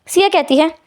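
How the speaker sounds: usual speaking voice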